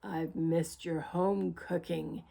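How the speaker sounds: lightly voiced wolf, low and fond; growled wolf. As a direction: purr it low into her ear. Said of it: "he growled in the other ear".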